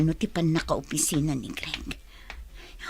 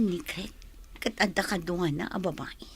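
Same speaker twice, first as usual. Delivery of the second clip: whispered, softer, stunned; shocked whisper; 2 semitones lower, 9 dB quieter than usual. Acted. Wife discovering a photo of another woman, whispering. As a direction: breathe it out in shock.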